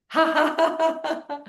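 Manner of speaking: high rhythmic laugh; exercise laughter. Shown as a demonstration. Leading the class in laughing together, high laughter. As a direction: burst into a run of high ha-ha laughs.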